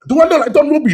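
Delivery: a mock-offended "do I look like him?"; indignant disbelief. Real, not acted. Indignant at being compared.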